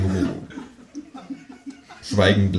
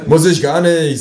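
raised, louder comeback; defiant retort; 8 semitones higher, 5 dB louder, slower than usual. A narrator's reading. Man squabbling with kangaroo, defiant. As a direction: snap back defiantly.

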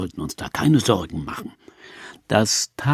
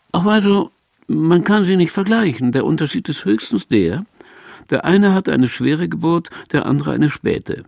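lightly raised mother voice; old mother's voice; 6 semitones higher than usual. Retold or imitated. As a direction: play the sly old mother, up high. Mother quoted, high voice.

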